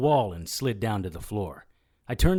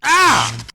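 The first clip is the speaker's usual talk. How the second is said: a sharp, much louder and higher cry of pain; pained yell; 17 dB louder than usual. Acted. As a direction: yelp as the prod bites. Zapped with the cattle prod.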